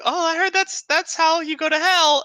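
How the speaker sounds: put-on high, loud boy character voice; hammed-up, high-pitched warning; Jack. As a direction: pipe up high with something you heard in class. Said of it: "He's like".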